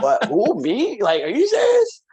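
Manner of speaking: what, me? can't believe it; incredulous. Retold or imitated. Called toxic by a friend, retelling his stunned disbelief. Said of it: "I was like".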